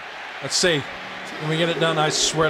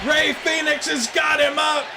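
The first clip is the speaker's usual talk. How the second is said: shouted play-by-play; 8 semitones higher than usual. Acted. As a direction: scream the near falls like a TV announcer. Commentary at full force.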